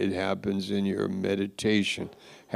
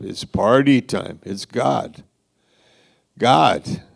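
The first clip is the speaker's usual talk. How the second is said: ordinary talk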